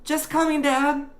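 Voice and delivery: put-on high, loud boyish call. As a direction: call through to the next room. Boy calls to next room.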